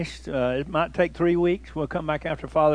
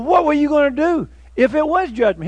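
higher, louder amused question; the neighbors quizzing the runner, higher and amused; teasing question; 9 semitones higher, 6 dB louder than usual. Retold or imitated. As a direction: ask it half laughing. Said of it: "And so they said".

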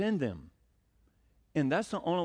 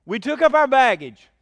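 proclaiming loudly; 6 semitones higher, 11 dB louder than usual. Real, not acted. Preacher proclaiming scripture.

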